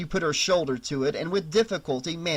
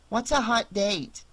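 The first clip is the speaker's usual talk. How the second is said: put-on higher-pitched child asking; little kid; 7 semitones higher than usual. A narrator's reading. Kid asks Annie what her words mean, child's voice. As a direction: put on a small child's questioning voice. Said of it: "he heard the kid".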